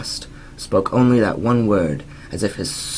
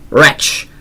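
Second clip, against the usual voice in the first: loud, higher anguished cry; 7 semitones higher, 9 dB louder than usual. Acted. Narrator crying out at the raven, loud anguished cry. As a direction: fling the word at the bird. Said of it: "I cried".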